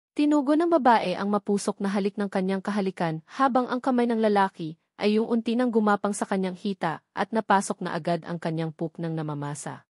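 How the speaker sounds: ordinary delivery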